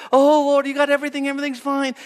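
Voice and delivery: overdone fake assurance to God; hammed-up phony worship; pretentious praise. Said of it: "It's so pretentious".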